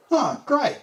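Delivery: high, loud, breezy mock response; cheery reply. Harrow answers the boy with a high breezy cheer. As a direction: brush it off brightly.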